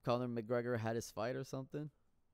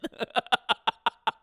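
loud, high cackle at his own joke; loud burst of laughter; 11 semitones higher, 12 dB louder than usual. Real, not acted. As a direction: crack up loudly.

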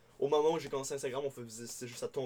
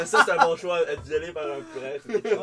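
laughing; 4 semitones higher, 10 dB louder than usual. Real, not acted. Cracking up at prete joke.

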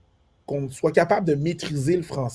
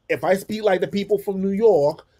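higher-pitched New York English; New York accent; 6 semitones higher than usual. Shown as a demonstration. Showing how he blends in with New Yorkers, New York accent. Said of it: "je vais parler comme ça".

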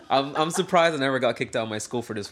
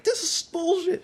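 retold tearful outburst. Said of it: "Then I start crying".

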